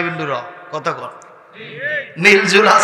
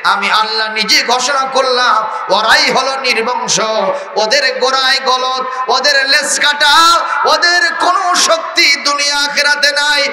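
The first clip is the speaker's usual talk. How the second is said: preaching at full force; 9 semitones higher than usual. Acted. Preacher proclaiming God's answer to the enemies, fiery shout. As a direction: thunder it out as God's own answer.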